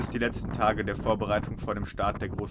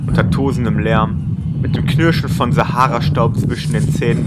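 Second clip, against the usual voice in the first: poetic narration; 11 dB louder than usual. Acted. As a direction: read it like a travel poem.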